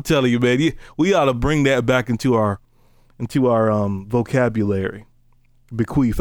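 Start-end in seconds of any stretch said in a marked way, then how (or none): none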